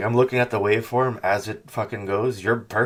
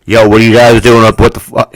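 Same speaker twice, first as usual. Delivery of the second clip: imitating someone yelling right on top of the mic, much louder; blown-out mic yell; 17 dB louder than usual. A caller screaming too close into a distorted phone. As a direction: friend mocks a caller whose mic distorts, blasting into the mic. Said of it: "screaming like he's standing on top of the mic".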